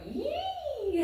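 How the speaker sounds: very high, long squealed "yee"; demon's "yee" cry. Acted. Reader imitating the demon again, high squeal. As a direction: hold a piercing, silly demon squeal. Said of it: "he's going".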